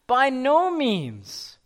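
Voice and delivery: BY NO MEANS high, slow and stretched; an emphatic, drawn-out refusal on "By no means". Acted. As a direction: draw out "By no means" with a high peak on NO. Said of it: "it's very emphatic".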